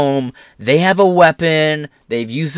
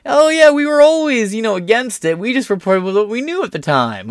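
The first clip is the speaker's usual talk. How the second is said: higher, sing-song fake-innocent voice; mock innocent outlet; 8 semitones higher than usual. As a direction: mimic a news outlet pretending it was always right, high and prissy. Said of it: "weird, like, grift away from it".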